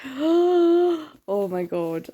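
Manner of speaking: gasped, slow "oh my god"; drawn out in awe; breathless gasp. Retold or imitated. Reader reacting to the ending, gasping. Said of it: "But it's like".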